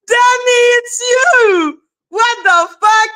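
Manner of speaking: 激动地喊; excited accusing shout. Retold or imitated. Catching Danny on the phone.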